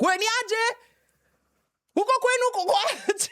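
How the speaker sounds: high squeaky dog voice, laughing; talking dog. Pretend. Dog greeting another dog at night, high playful voice. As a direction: play a chatty estate dog, voice pitched high. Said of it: "Now it will be like".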